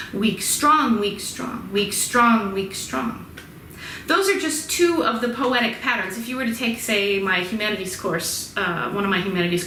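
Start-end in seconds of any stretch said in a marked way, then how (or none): 0.1-3.2 strong stressed, weak light; rhythmic counting. Shown as a demonstration. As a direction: count the iambs. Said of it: "short, long".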